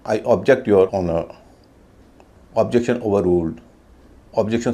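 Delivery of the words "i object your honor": ordinary talk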